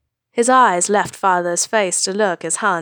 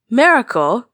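loud, high rising disbelief; incredulous; 4 semitones higher, 6 dB louder than usual. A narrator's reading. Han incredulous at Luke calling it a miracle. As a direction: scoff at the word in disbelief. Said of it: "Han's voice was incredulous".

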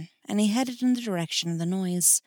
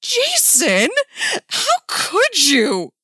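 loud, high, drawn-out mock heartbreak; hammy demand; 13 semitones higher, 8 dB louder, much slower than usual. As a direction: ham up the betrayed reproach. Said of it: "Dick demanded, equally as hammy as his siblings".

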